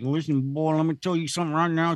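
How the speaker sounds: fast stern Southern mom; a clipped-back Arkansas threat, quick; Arkansas mom warning. Retold or imitated. Angry mother scolding her son, in a fast Southern twang. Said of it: "if I pissed her off".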